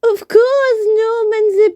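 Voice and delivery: very high squeaky chorus; high dismissive little-bee voices; little bees scoffing. Acted. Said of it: "they said".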